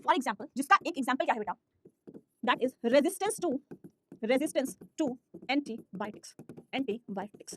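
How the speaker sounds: slow, measured dictation with long pauses. Real, not acted. Teacher dictating notes to her class, slow dictation. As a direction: dictate word by word, repeating each phrase.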